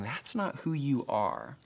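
soft, slow and tender; gentle compassionate teacher. Retold or imitated. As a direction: lay it down softly with love. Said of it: "out of love and compassion".

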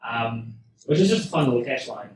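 ordinary talk

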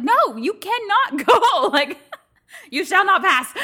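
loud, drawn-out laughing decree; laughing mock decree blocking her husband; mock forbidding. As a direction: block the door like a wizard. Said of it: "I'm like".

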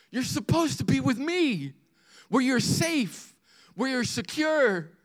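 high-pitched, earnest fatherly plea; pleading loving father. Acted. Father calling his son back to safety, pleading. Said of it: "It's not devastation or disappointment in the son".

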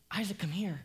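whispered urgent call. Acted. Father whispering urgently to his son to come close.

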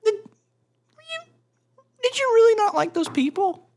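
squeaky high mock interviewer voice. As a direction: ham up a shrill, wide-eyed reporter.

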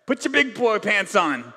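lightly imitated dad giving orders; a touch of fatherly no-nonsense, punched at the end; tough-love dad. Dad telling his kid to get over it, tough love. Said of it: "my dad used to say to me".

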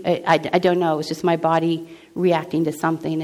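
ordinary talk, nothing marked

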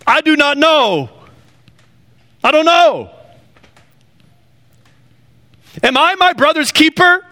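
high, incredulous defensive protest; indignant Cain. Acted. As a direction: play Cain as high-pitched and offended, throwing the question back. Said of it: "he said".

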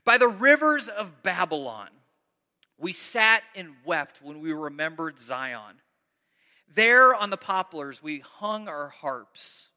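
loud, slow psalm read to the congregation; dramatic scripture reading. Acted. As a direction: declaim the lament with weight. Reads psalm aloud with force.

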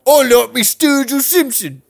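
high, drawn-out British caricature; hammed-up British squawk; mock British accent. Bandmate interrupting with a music request, high silly British accent. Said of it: "There he is doing British again".

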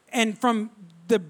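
ordinary delivery

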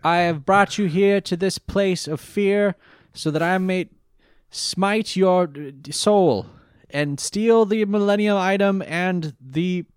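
over-the-top gloating villain; grandiose Merrick. Retold or imitated. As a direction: declaim the evil plan like a stage villain. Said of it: "grandiose sentences that he likes to lean into".

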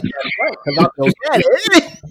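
high, laughing mock wrestler line; can barely get the punchline out for laughing; daddy punchline through laughter. Retelling the promo to cohosts, cracking up mid-line. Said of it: "He's like".

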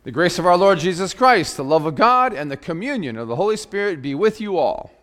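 bright, high, ringing call to worship; liturgical greeting. Acted. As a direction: greet the congregation like opening a service.

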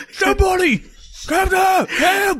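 mock scream for help. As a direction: playfully shout like a drowning man begging for rescue.

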